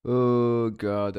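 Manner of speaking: loud exasperated groan; overwhelmed outburst. Real, not acted. Too many file versions.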